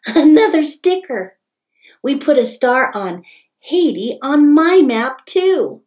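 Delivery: voiced dad. Papa's voice in story.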